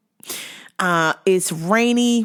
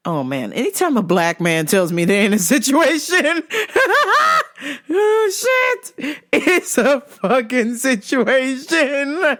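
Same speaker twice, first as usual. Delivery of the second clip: high squeal of laughter while talking; rising into helpless, high-pitched laughing; cracking up; 7 semitones higher than usual. Real, not acted. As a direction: lose it laughing mid-sentence. Cracks up at own retelling.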